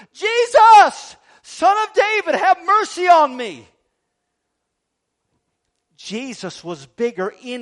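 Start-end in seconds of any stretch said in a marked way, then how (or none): 0.1-3.7 full desperate shout, much higher and louder; yelling even louder. Acted. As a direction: scream it over the crowd trying to hush you. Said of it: "caused him to yell out all the louder".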